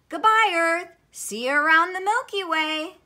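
high, loud farewell call; shouting. A narrator's reading. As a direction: call goodbye while zooming off. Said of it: "he shouted".

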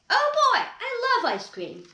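high, loud excited burst; thrilled exclamation. Acted. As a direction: squeal it with delight. Gerald excited about ice cream.

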